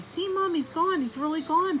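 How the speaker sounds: put-on high, insistent child voice; exclaiming boy. Simon insisting to Mom, exclaiming. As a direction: make Mom believe you. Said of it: "Simon exclaimed".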